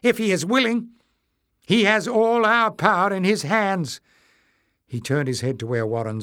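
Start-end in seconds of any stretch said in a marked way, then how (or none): 0.0-4.0 high loud call; calling back. A narrator's reading. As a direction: call back across the distance. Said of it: "Clef called back".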